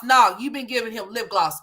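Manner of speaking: usual speaking voice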